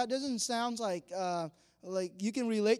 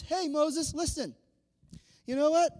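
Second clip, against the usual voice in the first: raised, higher call to Moses; God hailing Moses, a little louder; 6 semitones higher, 4 dB louder than usual. Acted. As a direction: announce it like a big news. God calling Moses in retold scene.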